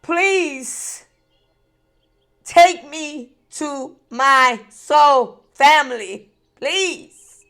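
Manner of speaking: loud, high, slow desperate plea; pleading prayer to God. Retold or imitated. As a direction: cry out to God that you are tired and used up. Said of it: "I told God, I said".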